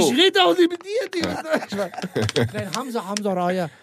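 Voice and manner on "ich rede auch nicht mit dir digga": high-pitched mock retort; teasing imitation. Comedian imitating his co-host on request, high and teasing. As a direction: mimic your friend in a raised, jokey voice. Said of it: "Ja, ich imitiere dich doch".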